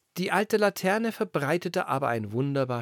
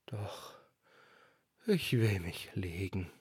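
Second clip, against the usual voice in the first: much softer, dying away; settling wind; 3 semitones lower, 5 dB quieter than usual. A narrator's reading. The wind settling down to sleep, fading. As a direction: let the gust fade out.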